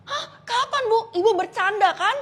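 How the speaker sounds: high, loud startled disbelief; shocked panic. Acted. Student panicking after the teacher says she will die soon. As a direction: gasp out the questions in panic.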